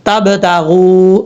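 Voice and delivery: تب تاغو drawn out very slowly with a nasal hum on the hidden noon; teacher reciting تب تاغو with the nasal ghunna; recited with ghunna. Shown as a demonstration. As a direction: hold the nasal sound of تب تاغو. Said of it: "یہاں پہ غنہ کرنا ہے اخفا کا".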